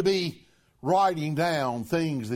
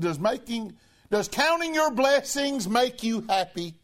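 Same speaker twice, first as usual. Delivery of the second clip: raised, higher preaching voice, building; 6 semitones higher than usual. Acted. Preacher urging the congregation to count blessings, raised preaching voice. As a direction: preach it out to the pews.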